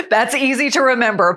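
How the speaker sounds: lifted, laughing reply; amused. Real, not acted. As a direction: chuckle while speaking, real amusement. Host amused by guest's superhero voice.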